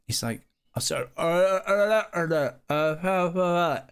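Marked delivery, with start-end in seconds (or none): 1.2-3.8 pained grunts. Retold or imitated. Can't talk through tooth pain.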